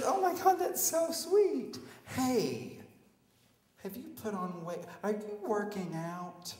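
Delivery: put-on high, drawn-out girly coo; mock sorority girl flattering someone; gushing sorority girl. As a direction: gush sweetly in a put-on female voice. Sorority girl gushes over him.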